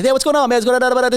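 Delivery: high, loud quick New York greeting; a hyped city guy rushing a hello; fast New Yorker. Retold or imitated. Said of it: "someone in New York talks really fast".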